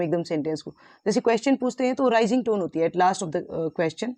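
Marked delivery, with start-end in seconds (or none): none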